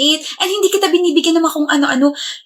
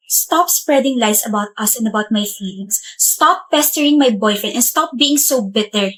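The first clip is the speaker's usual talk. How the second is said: ordinary talk, nothing marked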